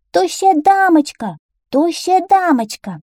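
high, ringing call for someone. A narrator's reading. Children calling for the skinny lady, calling out. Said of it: "И стали звать".

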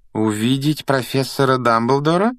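wary query, lifting at the end; suspicious echo question. A narrator's reading. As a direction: repeat it back with suspicion. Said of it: "переспросила профессор МакГонагал с таким видом, словно слова эти показались ей подозрительными".

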